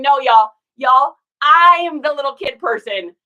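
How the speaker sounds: loud, emphatic mock protest; imitating mom speaking up. Speaking as mom raising her hand to object, loud and emphatic.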